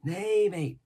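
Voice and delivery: an odd, jittery hermit rattling on; eager old castaway. A narrator's reading. Ben Gunn voiced in reading.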